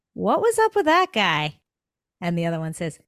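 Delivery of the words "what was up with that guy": high, loud, incredulous question; lightly acted baffled fish, pitched up; puzzled young fish. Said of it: "the one fish turns to the other".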